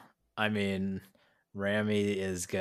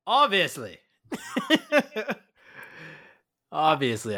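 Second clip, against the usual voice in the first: haughty; 9 semitones higher, 7 dB louder than usual. Acted. Player answers in character, haughty.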